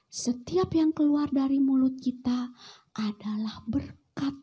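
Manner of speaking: ordinary talk, nothing marked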